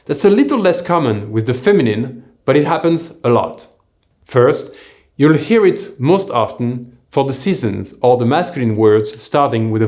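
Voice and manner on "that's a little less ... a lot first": ordinary talk, nothing marked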